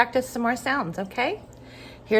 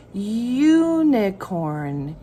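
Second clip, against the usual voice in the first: slow stretched word; much slower than usual. Shown as a demonstration. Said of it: "What's the first sound?".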